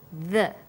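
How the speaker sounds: a lone voiced th as in "The", tongue between the teeth; "The" on its own, voiced th with a short neutral vowel; isolated th sound. Shown as a demonstration. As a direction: say "The" as a bare "thuh" sound. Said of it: "your tongue is between your top and bottom teeth".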